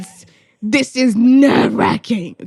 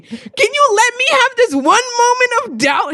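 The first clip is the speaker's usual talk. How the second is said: normal talking voice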